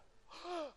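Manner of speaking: high startled gasp; sharp gasp. Acted. Mary gasps as she recognizes Jesus, a high sharp gasp.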